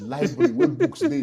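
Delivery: pained rising cry over the empty shelves; rising outcry. Real, not acted. Telling the interviewer about coming home to empty shelves, pained outcry. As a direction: voice jumps high on where books dey. Describing the emptied house.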